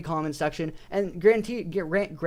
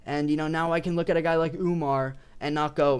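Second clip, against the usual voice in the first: ordinary talk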